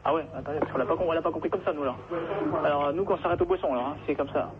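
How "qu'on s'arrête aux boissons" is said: ordinary delivery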